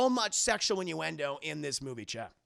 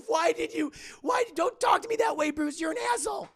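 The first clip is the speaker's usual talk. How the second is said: high, loud quarrel voice; sputter, then yell back in outrage; mock furious woman; shrieked indignation; 12 semitones higher, 6 dB louder than usual. Acted quarrel, Andrea hitting back.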